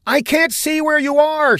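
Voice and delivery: high-pitched yell; yelling back. A narrator's reading. Blindfolded woman answers Saffinger through the fire, yelling. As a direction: yell blindly toward a distant voice. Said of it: "she yelled back".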